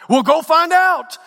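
high, loud bark of an instructor; shouted, urgent instruction; barked order. Acted. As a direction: snap out the command over a storm. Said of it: "barked the instructor".

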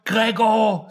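high-pitched drawn-out cry; Helen's scream. A narrator's reading. Helen crying out after Gregor, high scream. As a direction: cry the name out high, without much extra volume. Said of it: "skreg Helen".

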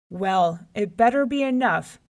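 loud, threatening reply; grumpy lion. A narrator's reading. Old lion warns the rabbit, demanding and grumpy. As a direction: warn him like a hungry bully.

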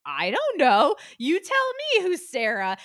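loud, high, slow snap back at the agent; indignant retort. Retold or imitated. Retelling her snapping back at agent.

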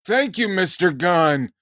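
a hint of a weary old fan; old man voice. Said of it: "doing this podcast as seventy-year-olds going".

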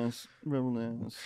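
put-on slow British-accented announcer. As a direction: say it like a British presenter. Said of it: "sexier with it".

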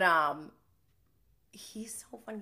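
ordinary talk, nothing marked

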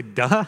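puzzled echo of "duh", hard d for "the"; "duh" with a d where "th" belongs; child's word echoed. Retold or imitated. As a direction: repeat "duh" as a baffled question. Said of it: "you say".

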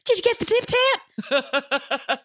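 slow, high-pitched mock quote; put-on gag voice. Co-host tossing in a running joke, silly put-on voice. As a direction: squawk the catchphrase high and drawn out.

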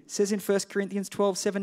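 ordinary delivery

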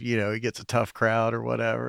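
usual speaking voice

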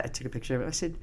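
ordinary talk

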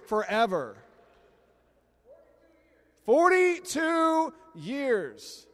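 high, loud and very slow shout; excited shout. Real, not acted. Pastor proclaims to the congregation how long the couple has been married, in a high, loud, drawn-out shout. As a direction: stretch it out and shout it to the room with pride.

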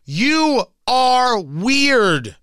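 very slow, each word landed; drawn-out scornful verdict; slow, deliberate put-down; slow emphasis. Real, not acted. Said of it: "You're weird".

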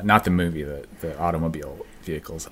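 ordinary talk, nothing marked

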